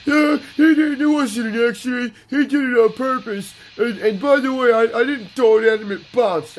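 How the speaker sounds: put-on high, loud, whiny kid protest; whiny tattling kid. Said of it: "the kid goes".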